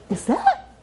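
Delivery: high, questioning double-take; startled disbelief. Retold or imitated. Queen spotting homeless-looking husband, startled disbelief. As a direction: pitch it way up, incredulous.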